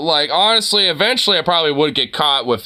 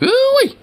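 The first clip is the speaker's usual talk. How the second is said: piercing squealed ooh-wee, way up high and loud; a cowboy whoop that cracks into a squeal; 4 dB louder than usual. Pretend. Showing off his Southern whoop to chat, squealed very high. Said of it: "Squeals literally like a pig getting gutted alive".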